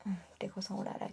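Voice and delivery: hushed, breathy aside to the camera; real scared whisper. Real, not acted. Vlogger hears a noise while filming, whispering nervously. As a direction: drop to a nervous whisper, listening.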